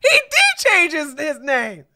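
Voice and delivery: high, loud laughing exclamations; laughing shout. Real, not acted. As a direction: laugh out loud at your own mix-up. Laughing at own mistake.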